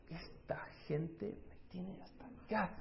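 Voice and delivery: soft whispered grumble; exasperated whisper. Pretend. Moses imagined fed up with the people, whispered grumble. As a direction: whisper it as if fed up under your breath.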